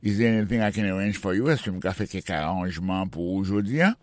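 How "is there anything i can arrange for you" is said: quick conversational run-through; natural fast pace. Shown as a demonstration. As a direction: say it fast like a native. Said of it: "same sentence at speed". (pale vit tankou yon natif)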